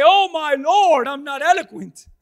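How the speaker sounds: a hammed-up plea of inadequacy; pleading cry. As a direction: cry out that you're not eloquent. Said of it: "come before the Lord and say".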